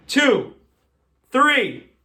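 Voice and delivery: high, loud, separate beats; shouted count. Real, not acted. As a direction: call each number out to the crowd. Loud count before magic trick.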